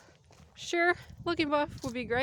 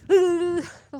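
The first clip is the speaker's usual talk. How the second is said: forced laugh; 6 semitones higher, 12 dB louder than usual. Retold or imitated. Shivering kid laughing.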